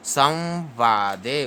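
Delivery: slow "संवादे" with a held nasal half v; half-v demonstration. Shown as a demonstration. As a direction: stretch "संवादे" as saw-vaa-de. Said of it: "इसको आधा व पढ़ा जाता है".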